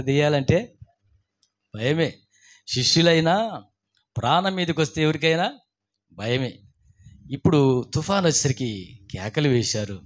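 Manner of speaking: ordinary talk, nothing marked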